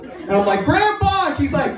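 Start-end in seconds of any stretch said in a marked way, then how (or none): 0.7-1.3 high-pitched alarmed cry for help; mock panicked call. Grandson calling grandpa as grandma forgets him, panicked.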